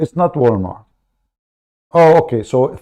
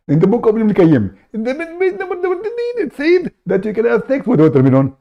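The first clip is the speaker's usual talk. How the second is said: raised, pushy put-on caller voice; fake caller voice; 10 semitones higher than usual. Prank caller cutting into the host's show, high put-on voice. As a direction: barge in as an eager fake caller.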